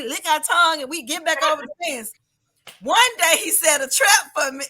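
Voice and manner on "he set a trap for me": ordinary talk, nothing marked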